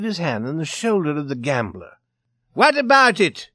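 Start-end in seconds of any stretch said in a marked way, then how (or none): 2.5-3.5 loud, high angry question; blustering, angry Swede. A narrator's reading. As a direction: challenge him in a raised voice. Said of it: "he asked angrily".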